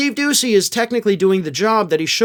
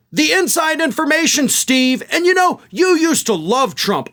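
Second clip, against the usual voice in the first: imitating a snappy retort, pitched higher; mock snapping; 9 semitones higher than usual. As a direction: mimic her snapping back at the host, higher. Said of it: "That's when Habba snapped".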